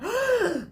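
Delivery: sharp high gasp; crowd gasp. Acted. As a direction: gasp in shock. Narrator voices crowd gasp.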